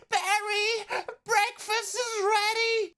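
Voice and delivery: high, slow calling mom voice; mother calling up. Acted. Mother yells up the stairs for breakfast, high call. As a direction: call upstairs like a bee mom. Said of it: "yells up at Barry".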